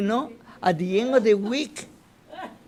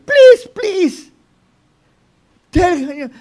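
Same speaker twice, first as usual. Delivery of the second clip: high, loud desperate plea; begging shout; 10 semitones higher, 12 dB louder, much slower than usual. Retold or imitated. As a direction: cry out begging for mercy. Frightened people begging Moshe.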